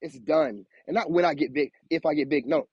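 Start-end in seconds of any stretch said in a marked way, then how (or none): none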